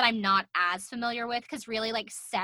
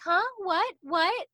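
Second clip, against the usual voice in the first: higher, puzzled mock what; mock confused Brooke; 9 semitones higher, slower than usual. Podcaster imitating Tyra's dazed Brooke impression, higher puzzled. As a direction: play dazed and lost. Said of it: "she's like".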